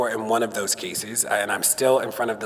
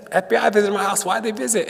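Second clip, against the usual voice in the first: higher, insistent challenge; pointed question; 8 semitones higher than usual. Retold or imitated. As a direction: press her for an answer. Confronting the Attorney General.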